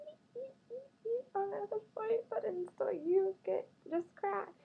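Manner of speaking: a hammed-up whiny squeal while pestering him; squeaky mock cry. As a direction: squeak and whimper like a pestering little creature. Mimics her pestering him.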